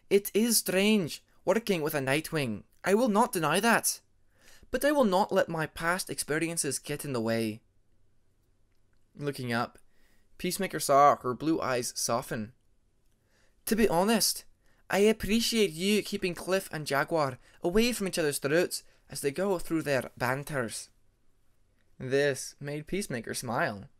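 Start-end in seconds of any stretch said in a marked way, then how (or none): 0.1-7.6 high, louder, measured dragoness speaking firmly; a proud dragoness setting the record straight; stern Ice Wing dragoness. Acted. Ermine's voice held. 13.7-20.9 high, louder, measured dragoness speaking firmly; a proud dragoness setting the record straight; stern Ice Wing dragoness. Acted. Ermine's voice held.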